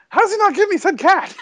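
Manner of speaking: high, outraged joking whine; indignant mock protest. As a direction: act cheated and indignant. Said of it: "I'm like".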